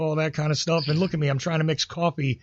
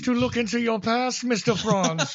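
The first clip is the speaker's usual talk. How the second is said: higher, laughing mock officer voice demanding answers; mock interrogator; 6 semitones higher than usual. Teasing a friend about his German roots, hammed-up interrogator voice. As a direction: play a hammed-up suspicious border officer, grinning through it.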